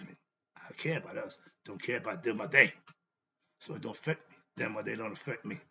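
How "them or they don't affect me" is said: ordinary delivery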